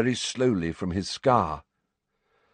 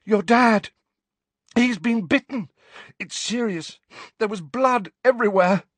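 high, loud, urgent bursts; frantic alarm; 12 semitones higher, 5 dB louder than usual. Acted. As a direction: gasp out terrible news, desperate to be believed. Harry desperately reports the attack.

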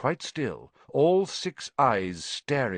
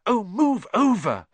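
high, loud impatient snap; exasperated bossy order; snarled; 12 semitones higher, 5 dB louder than usual. A narrator's reading. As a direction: shove in with an irritated snarl. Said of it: "Hermione snarled".

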